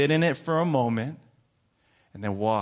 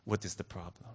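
put-on mother's light Filipino accent, lower; soft, low Filipino-accented question; 4 semitones lower, 7 dB quieter than usual. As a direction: imitate a Filipino mother gently asking. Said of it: "in her Filipino accent".

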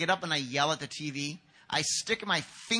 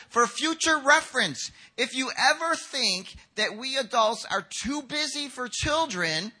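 raised, higher-pitched admonishment; emphatic admonishing; 6 semitones higher, 3 dB louder than usual. Acted. Jesus warning disciples about turning children away, emphatic admonishing. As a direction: lay down the rule firmly.

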